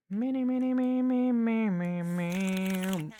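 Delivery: high, squeaky silly babble. Pretend. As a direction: squeak it like a cartoon.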